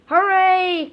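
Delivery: high, loud cheering hooray; excited cheer. A narrator's reading. Kids cheering at the news, loud and high. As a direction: cheer like a class of delighted kids. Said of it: "cried the children".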